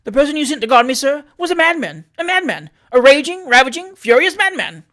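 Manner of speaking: high, loud, outraged tirade; furious rant. Acted. Traveller berating the mayor over his madman guard, furious shouting. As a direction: spit out the complaint in rising fury.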